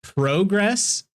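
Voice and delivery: questioning, mock-British "Progress" as PROH-gress; British pronunciation.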